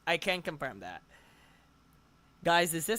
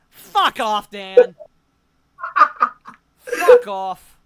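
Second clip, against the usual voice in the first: much louder, higher outraged yell; 8 semitones higher, 13 dB louder, much slower than usual. Real, not acted. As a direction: scream it at your friend in outrage. Yelling at friend, embarrassed.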